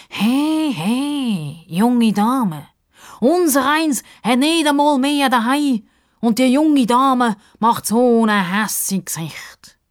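high, loud, drawn-out grievance; indignant Justus. A narrator's reading. Sidekick scolding the cross young lady, high and aggrieved. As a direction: complain theatrically, stretching words.

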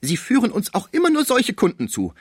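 high, loud shrill complaint; quarrelsome wife. A narrator's reading. Madame Vitelot scolding the agent, high and loud. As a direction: pick a fight in a high voice. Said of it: "begann einen Streit".

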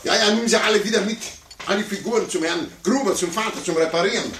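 high, fast, spiteful, chasing him out; high-pitched huffy order to get out; shrill throwing out. Acted. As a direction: get rid of the kid in a huff. Throws the child out after losing.

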